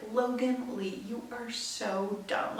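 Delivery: quiet, slow self-scolding; soft, slow self-reproach. Retold or imitated. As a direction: mutter the put-down to yourself slowly. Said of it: "it usually went like".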